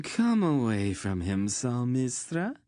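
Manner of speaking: high, whiny petulant order. Acted. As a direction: demand it like a spoiled favorite. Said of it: "the young man on the dais ordered petulantly".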